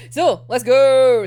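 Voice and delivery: loud, slow, rallying call; excited kickoff. Real, not acted. Starting the chapter with the viewers, a loud eager call. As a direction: launch into it with big energy.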